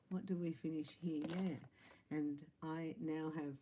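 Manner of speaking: usual speaking voice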